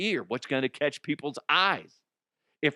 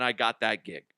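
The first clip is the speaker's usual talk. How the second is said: ordinary delivery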